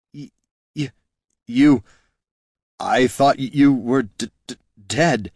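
frightened stammer, voice jumping high. A narrator's reading. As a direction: stutter it out in panic. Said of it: "stammered Jin".

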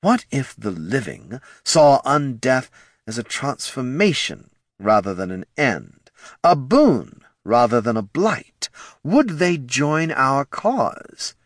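slow, swelling excitement; welling excitement. A narrator's reading. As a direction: savour the big idea. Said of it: "excitement welling up".

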